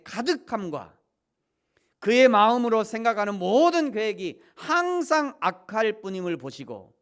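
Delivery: loud, high fervent preaching; preaching at full force. Acted. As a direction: drive the point home from the pulpit. (높고 힘찬 설교조)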